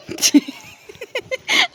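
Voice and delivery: real high-pitched laugh bursting out; can't hold back the giggle. Real, not acted. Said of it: "अब तो मुझे भी हंसी आ रही है". (ऊँची आवाज़ में असली हंसी)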